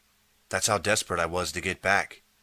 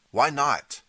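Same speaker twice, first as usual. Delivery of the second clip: sudden high, loud cry of protest; cried out; 8 semitones higher, 5 dB louder than usual. Acted. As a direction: blurt it out in dismay. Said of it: "I cried".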